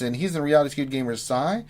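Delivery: ordinary talk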